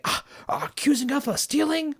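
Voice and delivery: mock outrage at being accused; indignant. Sandbender imitated, scoffing in indignant outrage. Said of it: "one of them was like".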